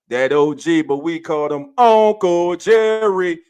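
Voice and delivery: loud announcer build-up to the name; hype announcement. Real, not acted. Host introduces the OG of the family, loud hype call. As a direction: belt out the regular's nickname.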